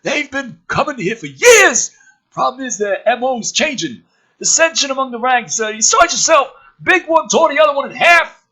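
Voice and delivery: high, loud brash military man; gruff officer voice. Acted. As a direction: play the cocky colonel loud and high. Woodhurst voiced in script.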